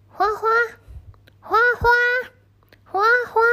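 high, loud worried calls, rising each time; anxious calling. Acted. Grandpa anxiously calling his snake, high and loud. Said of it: "爷爷紧张地叫着花花的名".

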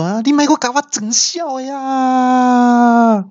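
high, stretched comic outcry; big yell. Retold or imitated. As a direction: wail out in exasperation. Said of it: "那我就大叫说".

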